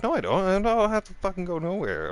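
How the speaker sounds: high-pitched, protesting retold refusal; defiant refusal. Partygoer refusing his ex's order to leave, defiant protest. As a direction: dig in and protest, pitched well up.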